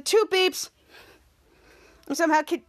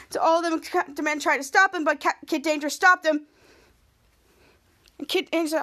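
ordinary delivery, nothing marked

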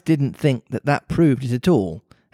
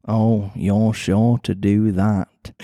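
put-on Mancunian accent; 4 semitones lower, slower than usual. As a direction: lower Mancunian cat voice. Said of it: "pretty Mancunian sounding accent".

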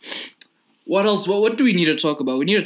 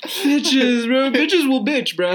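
high laughing exasperation; 9 semitones higher, 3 dB louder, much slower than usual. Real, not acted.

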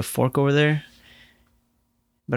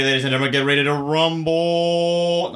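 ring announcer; 3 semitones higher than usual. Pretend. Announcer opening the podcast.

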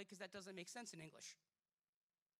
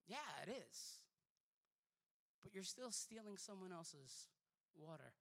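ordinary talk

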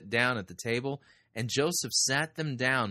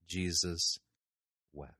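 reverent, hushed quote; slow pause; 4 semitones lower than usual. Acted. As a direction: let silence hang between the two words. Quoting shortest verse.

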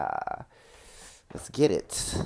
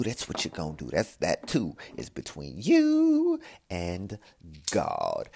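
normal talking voice

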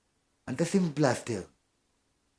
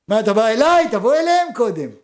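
indignant thief; 5 semitones higher, 5 dB louder than usual. Acted.

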